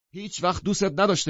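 high, loud, heated declaration; agitated shout. A narrator's reading. Gatsby jumping up at the husband, agitated shouting. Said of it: "برانگیخته از جایش بلند شد و داد زد".

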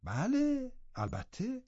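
high, soft servant's confirmation; quietly insistent servant woman; maidservant. A narrator's reading. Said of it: "زن خدمتکار گفت".

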